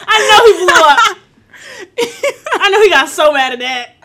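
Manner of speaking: loud laughing words; cracking up while talking. Real, not acted. Friend laughing at the story.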